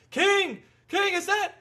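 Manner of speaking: hammed-up ringside call to King; Jim Ross. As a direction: yell it like a wrestling commentator. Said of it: "and Jim Ross is going".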